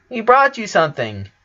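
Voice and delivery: put-on high little girl voice, proud; proud twins. A narrator's reading. Twins presenting a drawing, high proud voice. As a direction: show off the gift.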